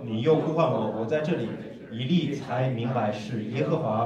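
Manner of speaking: loud, measured reading aloud to a congregation; scripture reading. Acted. Interpreter leads congregation in reading Samuel's calling, loud scripture reading. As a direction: read it out strongly, leaning on the phrases.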